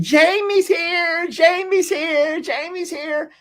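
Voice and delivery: high, slow sing-song announcing; mock chat excitement. Mocking the chat's excited call, high sing-song. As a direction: tease the chat with a high singsong. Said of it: "everyone starts saying".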